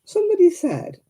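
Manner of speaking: usual speaking voice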